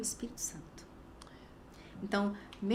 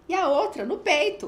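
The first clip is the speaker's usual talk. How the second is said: higher, loud exclamation of disbelief; retold astonishment; 7 semitones higher, 10 dB louder than usual. Friend listing what she saw at the house, astonished. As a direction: pile it on, comically aghast. Continues friend's shocked account.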